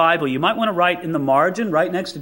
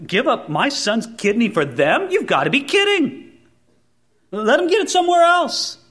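indignant refusal; 6 semitones higher than usual. Retold or imitated. Refusing kidney to enemy.

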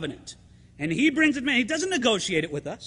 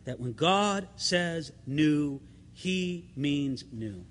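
slow, softer, stress on "new"; emphatic new; 7 semitones lower, 6 dB quieter, much slower than usual. Real, not acted. Pastor insists to the congregation on the word "new", slow and soft. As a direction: press down on "new" slowly. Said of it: "I want to emphasize it".

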